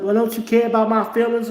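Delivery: high, wounded mock mother; mother's hurt voice. Mother at cookout asking about her feelings, high.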